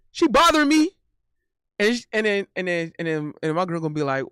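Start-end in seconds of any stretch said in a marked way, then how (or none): 0.1-0.9 loud, high playful tattling; mock complaint. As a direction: tell on someone to your girlfriend, hammed up. Acts out complaining to girlfriend.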